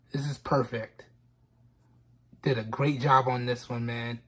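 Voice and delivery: low, softened, choking up a little; holding back tears. Real, not acted. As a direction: drop low and soft, moved. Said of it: "I'm trying not to cry".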